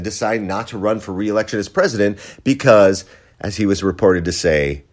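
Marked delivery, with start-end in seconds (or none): none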